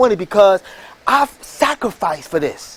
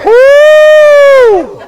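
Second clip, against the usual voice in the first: long, loud, high whoop; 9 dB louder than usual. Real, not acted. Celebrating with the audience, a loud high whoop. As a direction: let out a victory whoop. Crowd hype.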